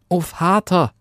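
high little voice calling father; Daumes Dick calling out. A narrator's reading. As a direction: pipe up as a tiny child. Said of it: "rief Daumes Dick".

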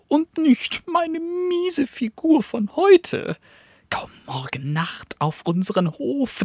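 high, fast, bragging dog voice; sly little dog. Acted. Cunning little dog tempts the wolf, high and eager. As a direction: tempt him with a tiny eager voice.

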